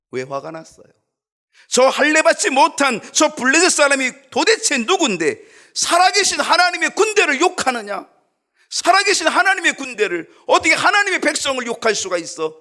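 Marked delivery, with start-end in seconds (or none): 1.7-12.6 loud, high, indignant shouting; David's angry outburst. Acted. David, furious at the Philistine mocking God's army, shouting. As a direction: roar it out, outraged at the insult to God. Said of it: "다윗은 아주 화가 났습니다".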